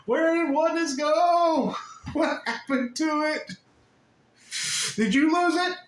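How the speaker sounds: a frantic little angel asking where oneness went; panicked little angel. Acted. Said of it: "Unhappy little angels ... freaking out, going".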